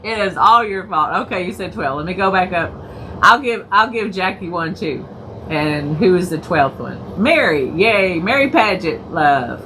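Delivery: ordinary talk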